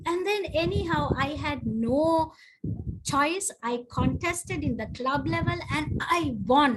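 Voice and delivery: normal talking voice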